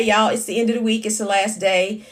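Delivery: normal talking voice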